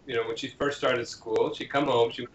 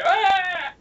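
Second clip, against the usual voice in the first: high playful shriek; mock scream; 4 dB louder than usual. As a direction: shriek like a scared kid at play. Mock scream in pretend play.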